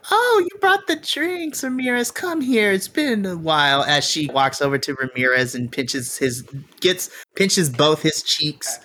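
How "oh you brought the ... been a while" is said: put-on high, sweet old-lady voice; doting auntie welcoming him; old auntie greeting. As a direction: coo like a doting grandma.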